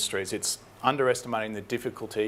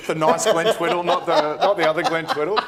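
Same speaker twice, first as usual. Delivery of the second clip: laughing tease; 6 semitones higher, 9 dB louder than usual. Real, not acted. Teasing banter, laughing loudly.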